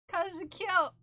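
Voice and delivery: high, loud gushing praise; excited pet praise. Real, not acted. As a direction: gush it out excitedly. Praising dog, repeated louder.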